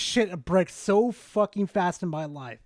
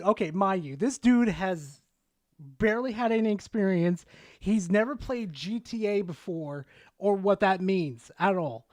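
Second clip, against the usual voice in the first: ordinary delivery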